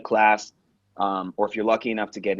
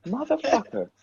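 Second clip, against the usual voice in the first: stunned realization. Retold or imitated. Hearing his brother's story in a lyric, stunned. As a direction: re-enact astonished recognition in a squeaky voice.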